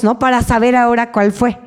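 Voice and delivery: ordinary talk, nothing marked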